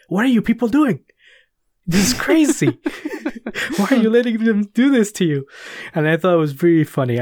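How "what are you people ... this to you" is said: incredulous Cassius. Retold or imitated. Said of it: "Cassius just gets in there and he's like".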